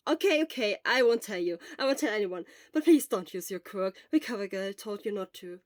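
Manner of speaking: loud, quick, eager friend; loud, urgent friend's voice; Hisashi, loud. Acted. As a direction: push it out fast and loud at the sick friend. Hisashi pleading loudly.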